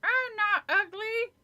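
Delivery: high, slow, through gritted teeth; ventriloquist's high dummy voice; squeaky dummy voice. Acted. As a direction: speak for the puppet without moving your lips. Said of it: "in Lindy's squeaky voice".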